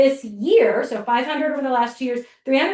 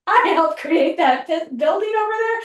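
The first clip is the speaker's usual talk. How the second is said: proud and excited; 6 semitones higher, 3 dB louder than usual. Retold or imitated. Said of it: "go like".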